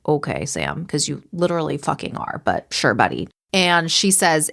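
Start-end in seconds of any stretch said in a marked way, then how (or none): 0.1-3.3 low, softer, dry sarcasm; mock agreement dropped well below usual pitch; sarcastic aside. Real, not acted. Host scoffing at Sam's claim, sarcastic.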